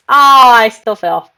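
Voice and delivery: slow, loud cry of dismay; loud wail. Real, not acted. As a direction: wail it out after the fall. Wails after falling in game.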